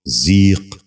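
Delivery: ذیق with a long "ee", "zeeq"; correct pronunciation. Shown as a demonstration. Teacher giving viewers the model, correct pronunciation. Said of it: "غور سے سنیے".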